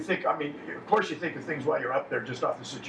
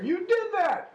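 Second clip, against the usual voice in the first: much higher, squeaky mock accusing voice; like an audience member pointing you out, with a pitched-up jab; mocking accuser; slower than usual. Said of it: "when they say".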